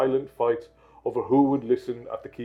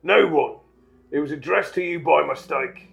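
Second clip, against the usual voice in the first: curt, slightly raised dismissal; short; 4 semitones higher, 4 dB louder than usual. Acted. Uncle brushes off nephew's question, curt. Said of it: "said Uncle Vernon shortly".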